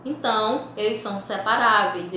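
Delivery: ordinary talk, nothing marked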